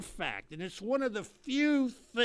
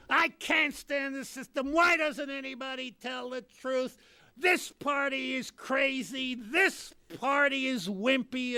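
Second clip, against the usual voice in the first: complaining voter; 7 semitones higher than usual. Retold or imitated. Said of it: "They're always going".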